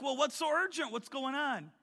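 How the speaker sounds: wondering why he called; puzzled question. Retold or imitated. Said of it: "And I'm like".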